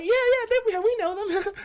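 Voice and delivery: high-pitched mock reassurance; mock eager reply. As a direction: fake breezy agreement. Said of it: "I was like".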